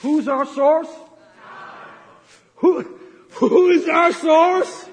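rising, loud, slow preacher's cry; preaching shout. Real, not acted. Pastor calling out to his congregation, loud and slow. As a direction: build it up and shout the question to the church.

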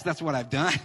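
ordinary talk, nothing marked

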